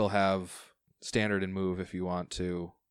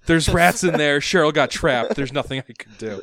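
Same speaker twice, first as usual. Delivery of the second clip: loud, high cry easing into laughter; mock-panicked excuse; 6 semitones higher, 11 dB louder than usual. Joking that Cheryl got trapped behind the door, mock-panicked. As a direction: plead helplessness, hammed-up and half laughing.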